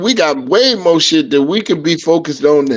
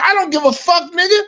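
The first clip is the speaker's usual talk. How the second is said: retold anger; 11 semitones higher than usual. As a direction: replay getting mad at a stranger. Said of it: "But I had got mad".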